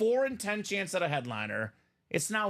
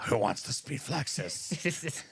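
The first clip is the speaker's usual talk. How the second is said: slow, lower sinister emperor voice; Palpatine voice; 5 semitones lower, slower than usual. Acted. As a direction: tempt them like a dark lord. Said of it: "Palpatine in the house".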